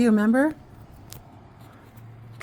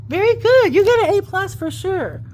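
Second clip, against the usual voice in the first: excited praise; 11 semitones higher, 4 dB louder than usual. Real, not acted. Praising the child's reading.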